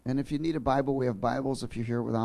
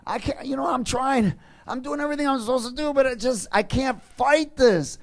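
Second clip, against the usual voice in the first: much higher and louder, breaking urgency; desperate outcry; 12 semitones higher, 6 dB louder than usual. Retold or imitated. Preacher voicing the struggling believer, desperate and loud. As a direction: fight through despair out loud. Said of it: "He's saying".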